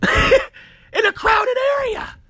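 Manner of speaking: outraged incredulous shout; exasperated shout. Real, not acted. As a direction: yell it in disbelief. Ranting about bombs in crowd.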